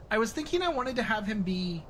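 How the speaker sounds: ordinary talk, nothing marked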